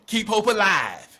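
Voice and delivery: loud quoted catchphrase; rallying slogan. Acted. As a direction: call it out like a rallying cry.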